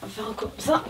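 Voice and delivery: ordinary talk, nothing marked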